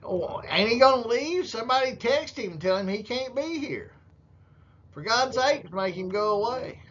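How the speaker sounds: high-pitched pleading imitation; mock panic. Voices Sydney panicking in the hallway.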